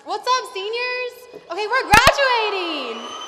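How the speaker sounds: rally the seniors with a big excited yell; excited loud cheer. Real, not acted. Graduating senior hyping up her classmates, excited shout. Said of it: "sorry if I'm really loud".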